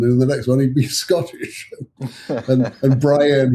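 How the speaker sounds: ordinary talk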